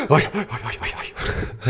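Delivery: "aye" on short breaths in; quiet, gasping "aye", repeated as a farmer parody; gasped aye. Shown as a demonstration. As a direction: suck in each "aye" softly. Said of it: "take an extra breath in".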